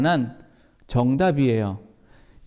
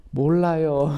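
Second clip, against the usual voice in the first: put-on evasive child; slower than usual. Child dodging the blame.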